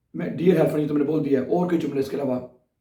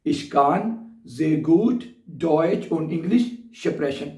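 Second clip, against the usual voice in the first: very slow model sentence; much slower than usual. Shown as a demonstration. Teacher models the English and German sentence, very slow. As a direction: stretch every German word, pause between chunks. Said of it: "कैसे कहेंगे?".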